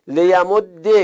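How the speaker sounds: لیمد with doubled d ending in "e"; kasra ending. Shown as a demonstration. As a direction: say لیمد as le-ya-mod-de. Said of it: "کسره اذا التقى الساکنان". (لیمد با دال مشدد و کسره)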